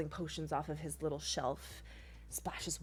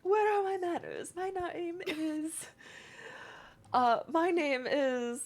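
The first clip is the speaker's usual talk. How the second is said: high, slow polite little creature; a tiny healer introducing himself with ceremony; prim creature host; 11 semitones higher, slower than usual. Acted. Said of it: "he goes".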